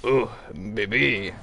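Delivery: hammed-up, mock suggestive; suggestive teasing. Baiting a friend who objects, mock suggestive. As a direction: tease with a joking come-on. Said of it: "don't say it like that".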